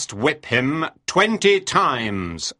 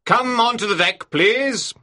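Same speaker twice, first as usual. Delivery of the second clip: loud summons to an officer; captain's shout; 3 semitones higher, 4 dB louder than usual. A narrator's reading. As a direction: call out a curt command across the ship. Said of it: "Captain Bligh shouted".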